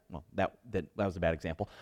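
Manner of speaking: normal talking voice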